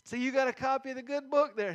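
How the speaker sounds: a hammed-up, overeager conversation starter; eager probing question. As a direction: push the question harder, fishing for an opening. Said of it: "and I was testing it".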